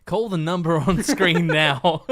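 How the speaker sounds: loud, punchy TV ad read; ad announcer voice. Pretend. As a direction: sell it like a late-night infomercial. Mock infomercial phone-in plug.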